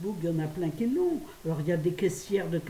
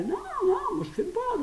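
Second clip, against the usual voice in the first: much higher, protesting non non; emphatic denial; 3 dB louder than usual. Real, not acted. Denying to viewers that she smokes, high and indignant. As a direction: squeal out the denial.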